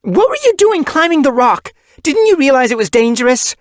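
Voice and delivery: high, loud angry scolding. A narrator's reading. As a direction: scold the kit angrily. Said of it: "Angrily, she turned on".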